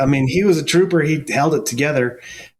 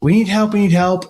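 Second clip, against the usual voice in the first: raised, higher call for help; retold yelling; 7 semitones higher, 3 dB louder than usual. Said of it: "they started yelling".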